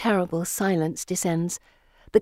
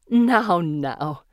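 chiding; 3 dB louder than usual. A narrator's reading. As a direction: gently scold with a drop on the repeat. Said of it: "Missus Nightwing chides".

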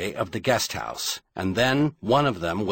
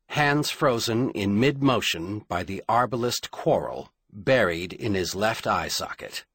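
usual speaking voice

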